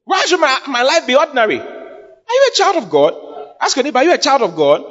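high-pitched, insistent sermon delivery; impassioned preaching. Real, not acted. Preacher challenges believers who doubt they can succeed, impassioned preaching. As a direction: fire rousing questions at the congregation, pitched high.

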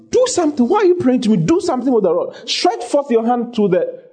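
high, forceful, exasperated command; commanding rebuke. Acted. God answers praying Moses at the Red Sea, exasperated command. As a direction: order them sharply, voice pitched well up.

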